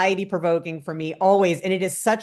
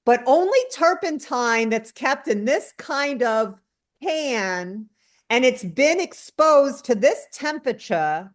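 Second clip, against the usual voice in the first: higher, slow, savoring connoisseur voice; Kevin Jodrey; 6 semitones higher, slower than usual. Retold or imitated. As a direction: relish each word like a fussy expert. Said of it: "I can't do his New England accent, so I'm not gonna try, but he'll be like".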